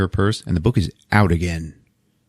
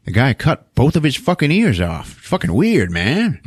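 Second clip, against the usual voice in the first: cop at scene; 6 semitones higher than usual. Retold or imitated. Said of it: "one of the cops is like".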